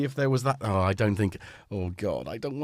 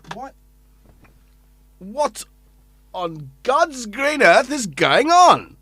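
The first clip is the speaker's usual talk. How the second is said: slow, louder and higher in disbelief; baffled outburst; 8 semitones higher, 12 dB louder, much slower than usual. Real, not acted. At the bizarre sound from the modular synth, a slow, loud, baffled outcry. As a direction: drag it out, bewildered. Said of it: "baffled by a strange sound".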